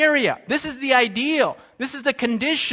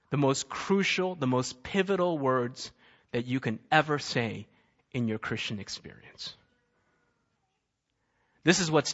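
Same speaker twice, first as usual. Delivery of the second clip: hushed preaching; 9 semitones lower, 5 dB quieter than usual. Acted. Preacher drawing the congregation close, lower and softer. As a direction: lean in and let the words land quietly.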